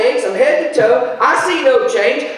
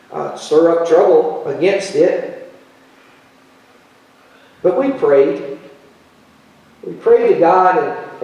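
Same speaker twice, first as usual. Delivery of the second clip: lower, measured Bible reading voice; scripture reading; 8 semitones lower than usual. Acted. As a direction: read the passage steadily to the congregation. Reads scripture passage aloud.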